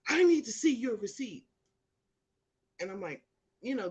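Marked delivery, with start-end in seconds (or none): 0.1-1.5 mock guard demand.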